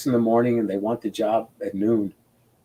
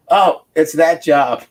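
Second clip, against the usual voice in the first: louder, higher, drawn-out re-enacted groan of recognition; dismayed recognition; 5 semitones higher, 6 dB louder, slower than usual. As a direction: act out spotting an embarrassing old mistake. Reliving dismay at seeing old job.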